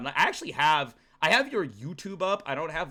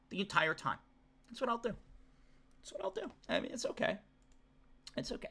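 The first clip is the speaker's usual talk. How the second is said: tiny, very soft wounded voice; mock sulking; 2 semitones higher, 11 dB quieter, faster than usual. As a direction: shrink away, pretending to be hurt. Sulks at chat, promising silence.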